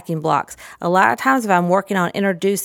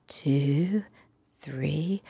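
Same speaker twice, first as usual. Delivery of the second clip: whispered countdown; 3 semitones lower, 9 dB quieter than usual. Shown as a demonstration. Building suspense before wake up for the child, whispered. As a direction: whisper the count, fading softer. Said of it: "I definitely change my intonation".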